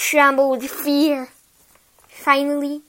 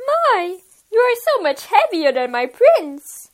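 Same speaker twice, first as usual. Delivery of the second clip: put-on girlish voice; 6 semitones higher, 7 dB louder than usual. Rapunzel voiced higher, loud.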